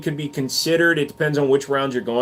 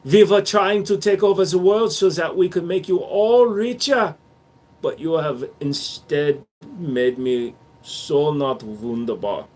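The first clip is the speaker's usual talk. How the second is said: mock German accent, grandly, then slowing down. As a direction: speak as a hurt German gun maker, German accent. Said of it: "That is a good German accent".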